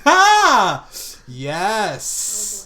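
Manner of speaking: loud, high burst of triumph; exultant shout, starting with a big "ha"; triumphant crowing. Real, not acted. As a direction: crow over a lucky roll. Celebrating a lucky dice roll.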